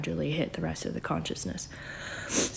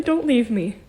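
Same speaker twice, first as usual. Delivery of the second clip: higher, louder plea of a clingy being; half-acted plea, higher and louder; pleading don't leave me; 5 semitones higher, 6 dB louder than usual. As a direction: beg like something that won't let go. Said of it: "type feeling".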